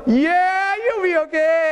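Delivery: high, stretched-out yell; shouting over noise. Retold or imitated. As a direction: drag it out high over engine roar. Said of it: "It's so loud".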